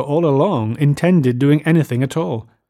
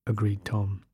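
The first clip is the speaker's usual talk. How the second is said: ordinary delivery, nothing marked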